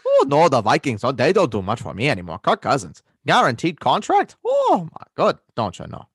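put-on animated, swooping Minnesotan accent; put-on Minnesota accent. Griping to co-host about the Vikings, Minnesotan accent. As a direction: lament in a hammy Minnesota accent. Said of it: "weird guy from Minnesota".

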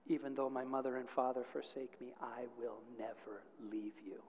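very soft, low scripture reading; tender reassurance. Retold or imitated. Someone answers the crying girl from scripture, tender hushed reassurance. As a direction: hush it, slowing to a near-whisper promise. Youth softly reads comforting verse to her.

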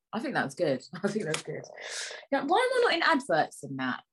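ordinary talk

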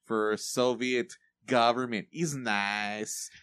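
put-on hammy Boris and Natasha Russian accent; bad Russian accent. Mocking Jennifer Lawrence's Russian spy with a bad Russian accent. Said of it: "the world's worst Russian accent ever".

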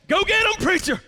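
high-pitched rallying call to the preacher; mock cheering on. Congregation egging on the preacher, mock cheering. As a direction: cheer him on like an approving congregation.

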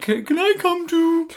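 high, slow, whiny little voice; mock a timid kid begging to tag along. Mocking a timid goalkeeper.

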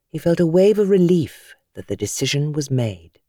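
ordinary delivery, nothing marked

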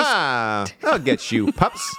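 villain cartoon mayor voice; gleeful cartoon villain taunt; Mayor Humdinger. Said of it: "I can do the mayor's voice a little bit".

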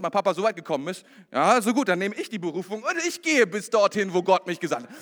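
loud, high, driving sermon climax; full-force preaching. Acted. Pastor thundering blessing over the congregation, loud and high. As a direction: preach it out to the whole church.

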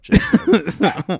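sudden loud high Jacob; loud call. Real, not acted. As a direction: call his name out sharply. Calls friend's name loudly.

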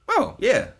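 high, loud mock-eager yes; eager acceptance. Answering an offer to pay his rent, hammed-up eagerness.

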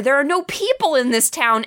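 ordinary talk

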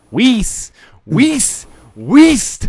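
"Weiss" said loud and high like "wise"; correct pronunciation. Shown as a demonstration. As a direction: shout "Weiss" rhyming with nice, high and loud. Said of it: "Pronounce it correctly".

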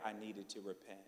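low, hushed admission; solemn confession. Real, not acted. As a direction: let it drop soft and grave.

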